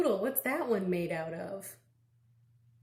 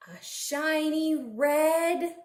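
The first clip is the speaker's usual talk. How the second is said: slow, louder drawn-out clue; slow teasing; 5 semitones higher, 3 dB louder, slower than usual. Acted. Reading a clue to kids, slow and teasing. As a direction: stretch it to build suspense.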